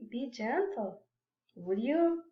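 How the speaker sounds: softly, gently asking; soft polite request. Acted.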